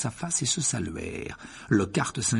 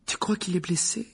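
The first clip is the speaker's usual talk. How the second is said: worried; 8 semitones higher than usual. A narrator's reading. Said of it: "d'une voix inquiète".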